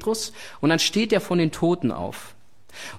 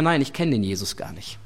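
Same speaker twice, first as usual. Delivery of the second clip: low, dismissive denial, voiced lower; curt denial; 7 semitones lower than usual. Peter brushing off the questioner, a low curt denial. Peter denies knowing Jesus.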